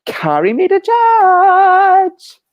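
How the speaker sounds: slow, high and loud, like bringing a guest on stage; announcer call. Acted. Host announcing guest to audience.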